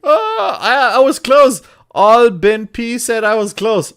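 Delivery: high, loud squeal of delight; overjoyed. Real, not acted. Streamer told his Polish was close, overjoyed. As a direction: burst out with delighted pride, high-pitched.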